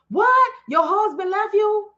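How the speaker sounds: high, loud mock gasp of disbelief; playing the shocked helper who gives it all away. Mocking herself, hamming up shock at a follower's news.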